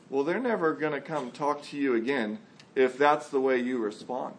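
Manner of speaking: ordinary delivery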